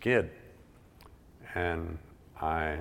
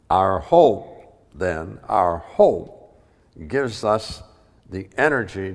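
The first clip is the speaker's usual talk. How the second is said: emphatic preaching; 9 dB louder, slower than usual. Acted. Emphatic repeated phrase.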